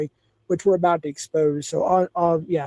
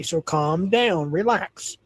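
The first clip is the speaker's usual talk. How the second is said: slow calm down; much slower than usual. Real, not acted. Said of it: "calm down or I have to ban you".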